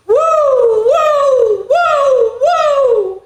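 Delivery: high, loud wailing siren; siren sound. Shown as a demonstration. Teacher doing the fire engine siren for kids, high. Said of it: "the sound that they, their siren makes".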